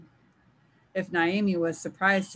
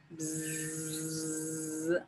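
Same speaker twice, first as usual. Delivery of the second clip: long, soft, steady buzz; buzzing hornets; 10 dB quieter than usual. A narrator's reading. Hornets circling overhead in the forest, quiet drawn-out buzzing. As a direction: hum a sustained hornet buzz. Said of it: "Maboro buzzing overhead".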